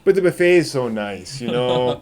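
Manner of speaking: put-on playful Fargo-style Midwestern accent. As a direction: chat like a folksy Upper Midwesterner. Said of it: "just be from the Midwest".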